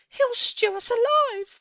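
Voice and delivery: put-on high, loud, slow wail of a scared child; high slow wailing kid; wailing boy. A narrator's reading. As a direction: drag it out like a terrified boy. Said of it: "wailed the second one".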